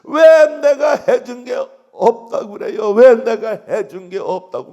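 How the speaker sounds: a drawn-out crying protest; wailing outcry. Retold or imitated. Deaconess wailing to the pastor about her daughter, slow and loud. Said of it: "막 그러면서 통곡을 하고 울어".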